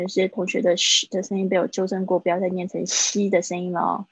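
normal talking voice

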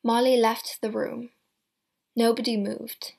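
ordinary delivery

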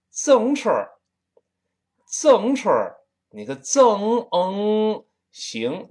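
loud high Tianjin 自行车儿, slowed; Tianjin accent. Retold or imitated. 天津口音，高声. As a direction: shout it like a Tianjin man. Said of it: "他说自行车儿".

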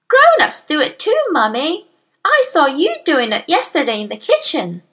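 put-on high, childlike comeback; cheeky little girl. A narrator's reading. Daughter catching mother out on nose picking, cheeky. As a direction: pipe up to tell on mother. Matilda answers mother back.

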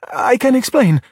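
high, urgent protest; panicked plea. Acted. Luca is exposed before Giulia, pleading.

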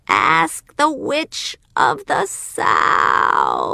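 slow, drawn-out call from far off; far away voice. Acted. Wizard calling from the rising balloon, distant and slow. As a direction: call it down from high in the sky. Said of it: "old man's voice very far away".

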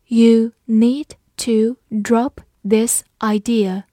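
slow, firm word-by-word reading; slow read-along. Shown as a demonstration. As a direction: give each word its own space for learners. Teacher's slow read-along before normal take. (慢速逐词领读)

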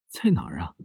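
frightened whispered question; scared whisper. Acted. Stepmother asks where the ghosts are, frightened whisper. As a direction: whisper it, afraid of what's behind you.

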